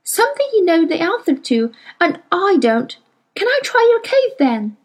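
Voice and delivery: put-on a touch of desperation in a young girl; Lyra, desperate. A narrator's reading. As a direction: beg like a desperate child. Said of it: "said Lyra desperately".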